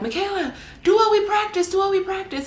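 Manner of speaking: shouted instructions. Retold or imitated. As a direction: yell it up over the water so she hears. Guides yelling at capsized kayaker.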